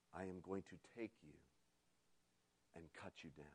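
soft, grave and heavy. A narrator's reading. The master tells bamboo he'll cut him, gravely and softly. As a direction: deliver it like a sentence passed. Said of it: "the master's voice was grave".

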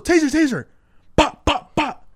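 high loud yell then shot sounds; yelled callout. Retold or imitated. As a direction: yell taser, then mimic gunshots. Cop yells then shoots.

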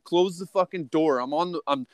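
normal talking voice

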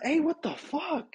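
high, drawn-out retold protest; alarmed protest. Man to sleeping girlfriend after freeing himself, alarmed.

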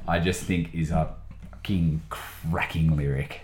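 hushed whisper. Real, not acted. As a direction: say it under your breath. Unvoiced whispered aside.

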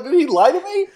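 high, loud, amused disbelief; incredulous. Retold or imitated. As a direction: squeal it as the lie dawns on you. Realizes friend faked being asleep.

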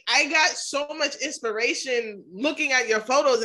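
normal talking voice

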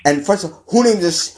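normal talking voice

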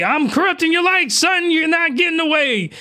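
high, taunting villain voice; hammed-up sneering captain; mock villain. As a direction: gloat over her like a cartoon bad guy. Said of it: "He's like".

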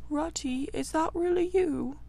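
a weak friend calling from a hole; feeble voice. A narrator's reading. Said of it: "came a feeble voice saying".

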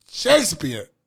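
loud, theatrical mock British accent; English accent. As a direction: declaim it in a hammed-up English accent. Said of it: "El acento inglés". (acento inglés teatral, en voz alta)

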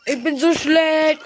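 slow, high, loud mock whine; mock self-pity. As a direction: whine it like fake despair. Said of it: "Nein, Spaß".

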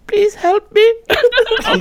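very high, loud pleading voice; imitated plea for help. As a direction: mimic a pathetic victim begging in falsetto. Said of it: "Did he sound like that for real?".

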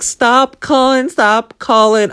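high, slow, drawn-out panicked cry; panicked yelling. Retold or imitated. To the escape room props, a high slow panicked plea. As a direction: beg it to stop, stretched out high. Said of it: "screaming [name] at the top of my lungs".

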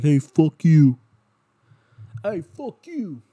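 high-pitched mock heckler's holler, drawn out at the end; imitated yell. Kid shouting at a cyclist out the window, mock yell. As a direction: mimic a kid hollering from a passing car. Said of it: "he was just yelling".

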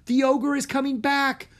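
loud, high alarmed warning; frightened yell. A narrator's reading. Breen warning Drake, loud and frightened. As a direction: yell it in panic. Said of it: "Breen yelled".

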